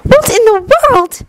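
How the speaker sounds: ordinary delivery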